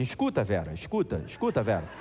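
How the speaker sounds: low, curt fatherly shush; lightly voiced father cutting her off; imitating dad shushing. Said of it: "ele ficava assim".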